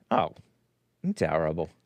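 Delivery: low dry mock-horrified terrible; mock disdain. Host joking about the family's descent, mock disdain. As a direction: sneer the word terrible, then take it back. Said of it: "not that that's terrible".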